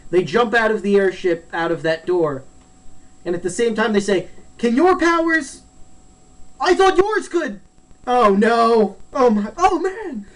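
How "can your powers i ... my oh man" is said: high, comically alarmed cries; mock panic. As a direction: act out sudden dread mid-fall. Retold panic while falling together.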